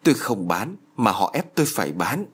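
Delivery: ordinary delivery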